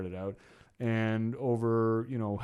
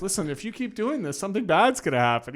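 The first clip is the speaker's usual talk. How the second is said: higher and louder, a mock stern warning; replayed threat to the boss; retold warning; 9 semitones higher, 6 dB louder than usual. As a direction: warn him off, replayed with heat.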